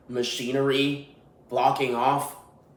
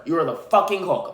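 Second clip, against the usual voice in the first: normal talking voice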